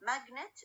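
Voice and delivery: "Magnet" with MAG as the strong syllable; stress on first syllable. Shown as a demonstration. Teacher showing where magnet is stressed, MAG strongest in "Magnet". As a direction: put the weight on MAG in "Magnet". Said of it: "الستريس على أول syllable".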